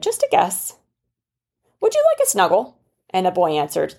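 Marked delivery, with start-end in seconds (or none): 0.0-2.8 cute bunny voice. Acted. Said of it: "And the bunny said".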